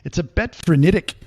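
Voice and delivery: "frenetic" said as "frenitic", Kiwi vowels; Kiwi accent. Retold or imitated. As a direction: bit said like "bet", frenetic like "frenitic". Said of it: "swap the I and the E".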